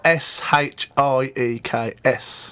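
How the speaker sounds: slow letter-by-letter spelling. Shown as a demonstration. Band member spelling the band's name for listeners, spelled out slowly. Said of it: "Shakes spelled".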